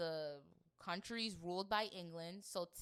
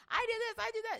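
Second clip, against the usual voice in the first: mock boasting; 7 dB louder, faster than usual. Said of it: "it's like".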